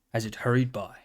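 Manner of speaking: plain reading voice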